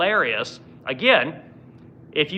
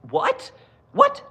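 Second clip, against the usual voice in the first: incredulous what; 13 semitones higher than usual. Real, not acted. Reacting to Congress saying technically, stunned disbelief. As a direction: squeak out the shock.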